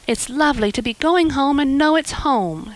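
put-on a girl thanking her new benefactor, eager; eager young Anne. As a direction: gush with hope like an imaginative girl. Anne voiced in story.

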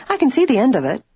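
high, lilting, hopeful voice; optimistic. A narrator's reading. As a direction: cheer the others on. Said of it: "declared optimistically".

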